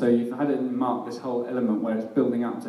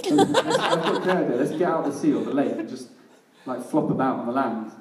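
Australian fish voice. Retold or imitated. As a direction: ham up an Australian fish bursting with a silly idea. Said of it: "this fish is Australian".

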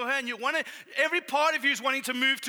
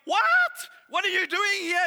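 high, loud, panicked demon cry; startled demons shouting in fear; terrified demons; 6 semitones higher, 6 dB louder, slower than usual. Acted. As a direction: shriek it out like a cornered demon. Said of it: "The demons are going".

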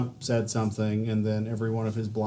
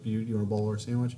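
fast, run-together imitation of the counter guy; quick mock server patter; rattled off fast; faster than usual. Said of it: "But he says it really fast".

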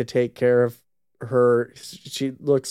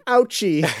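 mock ouchie; 6 semitones higher than usual. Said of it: "just saying".

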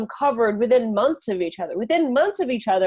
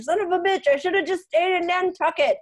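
high-pitched mock exasperation; exasperated regret; 7 semitones higher than usual. Convict just over the state line cursing himself, mock exasperated. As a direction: kick yourself in a high, comic voice. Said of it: "and was like".

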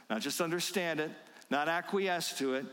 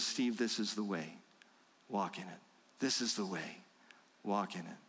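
softer and lower, a tender murmur at the shoulder; gentle fatherly nudge; 7 semitones lower, 4 dB quieter than usual. Acted. Heavenly Father squeezing Steve's shoulders, soft tender guidance. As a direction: coax him kindly from just behind.

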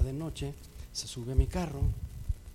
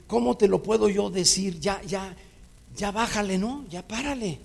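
higher and louder, exasperated calm-down plea; pleading to stop; 5 semitones higher, 5 dB louder than usual. Retold or imitated. The preacher pleads with the girlfriend to stop, exasperated and louder. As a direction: beg her to drop it.

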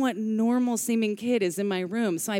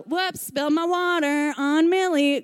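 high-pitched staged yell; yelled announcement; 6 semitones higher, 3 dB louder than usual. Retold or imitated. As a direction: call out a fake accident for the whole room.

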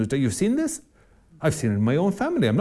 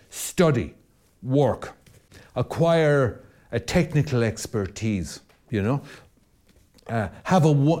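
usual speaking voice

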